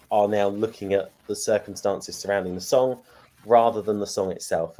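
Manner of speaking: usual speaking voice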